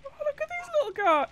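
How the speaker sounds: very high, loud cooing falsetto; gushing squeal. Real, not acted. Streamer spotting little creatures in the game, gushing squeal. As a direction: squeal over something adorable.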